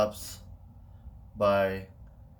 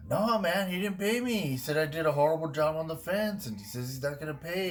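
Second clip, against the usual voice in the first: higher-pitched acted complaint; mock grievance; 7 semitones higher than usual. Friend fibs to his buddy about the fence pay, mock aggrieved. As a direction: play up being cheated out of pay.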